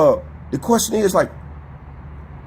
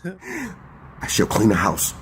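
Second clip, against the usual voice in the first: mock house servant voice. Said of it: "That's how the hell you sound".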